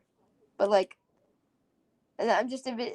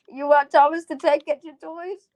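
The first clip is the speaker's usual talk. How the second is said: a hint of a cheap parent, higher and louder; mock parent; 6 semitones higher, 8 dB louder than usual. Stingy parent offering child knockoff toys.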